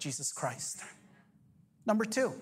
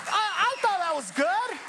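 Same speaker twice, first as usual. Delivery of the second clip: raised, fervent preaching voice; full-force sermon delivery; 9 semitones higher than usual. Acted.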